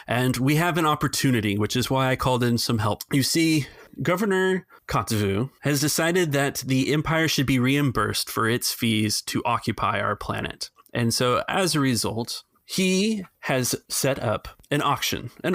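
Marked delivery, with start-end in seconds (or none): none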